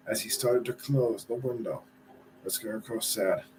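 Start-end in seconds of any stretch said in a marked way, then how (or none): none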